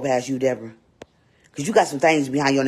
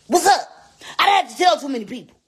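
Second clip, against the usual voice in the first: higher, louder and fast; loud emphatic repeat; 13 semitones higher, 5 dB louder, faster than usual. Real, not acted. Streamer insisting to viewers, louder and faster. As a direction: punch it out, insisting. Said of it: "repeats louder".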